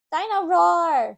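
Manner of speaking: put-on high, loud, slow toddler call; a piglet gleefully naming his toy; little George. A narrator's reading. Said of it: "says George".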